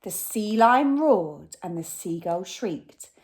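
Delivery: ordinary delivery, nothing marked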